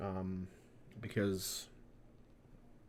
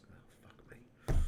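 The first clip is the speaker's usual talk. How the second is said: whispered curse; 3 dB quieter, slower than usual. Real, not acted. Frustrated aside while mispronouncing.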